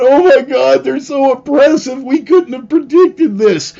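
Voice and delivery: high, loud whining mockery; mock whiny crying. Mocking the rebels as crybabies, high whiny wail. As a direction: whine like a crybaby caught out. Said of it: "they went crying to other countries going".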